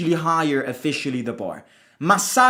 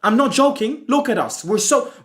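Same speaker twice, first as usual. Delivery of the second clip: usual speaking voice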